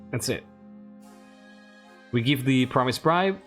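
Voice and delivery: ordinary talk, nothing marked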